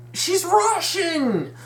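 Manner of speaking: high, drawn-out exasperated cry; exasperated exclamation. Real, not acted. Fan protesting Nastasha's dropped accent, exasperated. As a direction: stretch it out high in disbelief.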